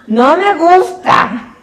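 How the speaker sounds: slow high shout of disgust; shouting that she hates it; shouted complaint. Retold or imitated. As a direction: drag it out loud and high, appalled. Retold reaction to friend peeing.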